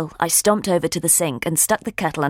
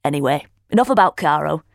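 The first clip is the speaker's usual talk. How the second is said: usual speaking voice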